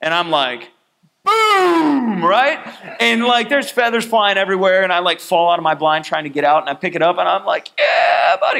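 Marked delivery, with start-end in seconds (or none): 1.2-2.2 loud high boom; imitated shotgun boom; mock gunshot. Retelling the shot. 7.8-8.6 triumphant cheer. Retold or imitated. Celebrating the kill.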